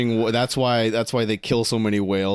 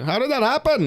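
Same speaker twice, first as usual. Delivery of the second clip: "How did that happen?" in a much higher, hammed-up Irish accent; 13 semitones higher than usual. Friend jokingly voices an Irish local, exaggerated Irish accent. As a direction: mimic a baffled Irish local, Irish lilt. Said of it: "You like that Irish accent, bro?".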